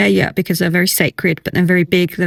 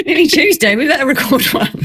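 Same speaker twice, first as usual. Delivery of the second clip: high, through a laugh; 7 semitones higher than usual. Real, not acted. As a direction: giggle the words out. Laughing through retold plan.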